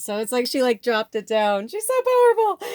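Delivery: loud, soaring, high-pitched exclamation; overjoyed. Real, not acted. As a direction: let real joy burst out, voice climbing very high. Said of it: "I'm just in so much joy".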